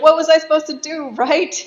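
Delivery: high, loud, quick retort; mock exasperated yell. Marquis arguing back at his wife, mock exasperated yell. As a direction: fire back in hammed-up outrage.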